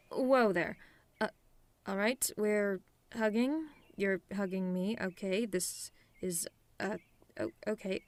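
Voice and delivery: halting, caught off guard by a hug; flustered. Acted. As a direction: stammer awkwardly through sudden surprise.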